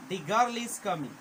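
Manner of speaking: put-on slow, with a long drawn-out "The" (dee) before girl; stressed the. Shown as a demonstration. Teacher showing students the emphatic the, drawn out slowly. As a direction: stretch "The" into a long dee, then say girl is coming. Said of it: "लंबा sound ले आना है".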